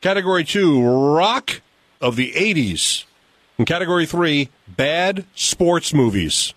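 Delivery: high, bright quiz-host read; game show announcer. Acted. Reads quiz categories over music.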